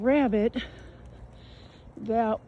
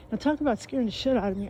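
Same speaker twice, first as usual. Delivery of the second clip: ordinary talk, nothing marked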